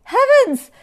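very high, loud yelp; alarmed exclamation. A narrator's reading. Anteater hearing lions like anteaters, alarmed. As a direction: yelp it in shock.

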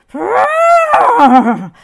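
loud, high elephant trumpet. A narrator's reading. Elephant too big to hide, trumpeting loudly. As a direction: blare like a panicked elephant. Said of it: "he trumpeted loudly".

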